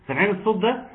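normal talking voice

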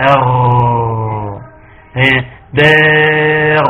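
long sustained gargled غ, written «أر» and «دار»; flowing ghayn. Shown as a demonstration. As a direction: hold the gargled sound in «أر». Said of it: "فيها جريان للصوت". (جريان صوت الغين في «أر» بغرغرة ممتدة)

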